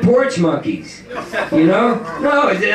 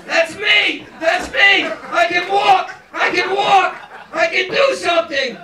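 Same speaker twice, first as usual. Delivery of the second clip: much higher, gleeful shouts of a tiny creature; high excited squeal; 10 semitones higher than usual. Acted. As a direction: squeal it out with childish pride. Voicing a baby learning to walk.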